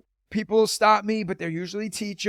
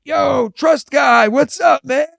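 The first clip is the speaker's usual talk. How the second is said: loud, slow mock shout; imitating rocker; 6 semitones higher, 8 dB louder, slower than usual. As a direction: hail him like a rocker dude. Rocker guy hails him.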